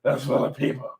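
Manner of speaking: hoarse, throaty mock-heroic voice; strained growl on the vocal cords; put-on vigilante growl. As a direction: rasp it out like a masked hero. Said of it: "Why are you talking like that?".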